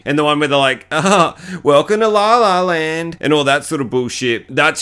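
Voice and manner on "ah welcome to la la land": higher, slow sing-song mock greeting; hammed-up drawn-out welcome. Friends mocking the old building, slow sing-song welcome.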